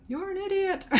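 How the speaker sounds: high, lightly voiced echo calling the insult back; the cave echo answers the Grinch, mock echo; echo shouting back. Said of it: "it shouts back to him".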